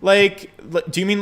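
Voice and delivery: normal talking voice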